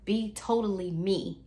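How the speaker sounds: usual speaking voice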